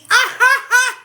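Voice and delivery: high, loud burst of laughter; real whooping laugh in three bursts; delighted laugh. Real, not acted. Speaker spotting a sign mid-talk, delighted high laugh.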